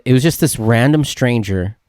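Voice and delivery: ordinary talk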